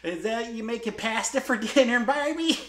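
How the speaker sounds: high Midwestern aunt voice; Midwestern accent. Retold or imitated. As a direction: imitate a Midwestern aunt asking. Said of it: "she's like".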